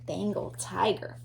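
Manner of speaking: hushed dramatic whisper of the name. Acted. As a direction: build suspense for the next animal. Announcing next animal in book.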